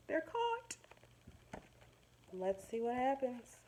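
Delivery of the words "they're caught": high, soft gasped dismay; mock alarm. Teacher gasping to the class as fish get caught, mock alarm. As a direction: gasp it like it's terrible news.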